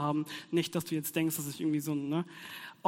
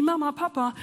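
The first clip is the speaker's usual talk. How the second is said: put-on child calling; 7 semitones higher, 4 dB louder than usual. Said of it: "dann rufen sie".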